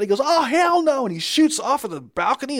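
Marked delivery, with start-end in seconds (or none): 0.2-1.0 much higher, louder mock panic; freaked-out outburst. Retelling a moviegoer bolting off the balcony, mock freak-out. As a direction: imitate someone freaking out, pitched way up. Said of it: "he freaks out".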